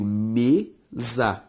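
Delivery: "mesa" drawn out as me, za; syllable by syllable. Shown as a demonstration. Teacher showing students the split, "mesa" syllable by syllable. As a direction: say "mesa" in two separate, lengthened syllables. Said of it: "veja só comigo".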